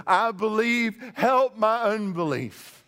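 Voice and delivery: high, loud, anguished cry of faith; a broken father crying out to Jesus for help, high and loud; desperate plea. Pretend. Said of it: "And he says".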